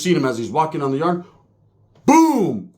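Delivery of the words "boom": loud exclamation. Retold or imitated. Fart blast sound.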